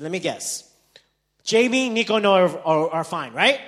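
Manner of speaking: loud, high, fed-up recital; exasperated guess. Acted. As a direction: rattle off the known news, fed up. Exasperated guessing of repeated news.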